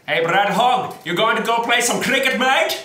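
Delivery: put-on loud, high South African accent. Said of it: "was that not a good South African accent".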